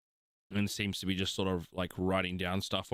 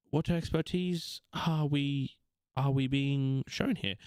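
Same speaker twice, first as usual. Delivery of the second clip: much higher, polite gracious princess; young elf princess, grateful; 6 semitones higher than usual. Acted. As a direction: lift the voice high and sound thankful. GM voices the princess.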